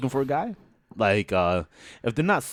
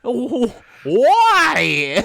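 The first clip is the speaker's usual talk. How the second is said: incredulous; 7 semitones higher, 5 dB louder, much slower than usual. Retold or imitated. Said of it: "I'm like".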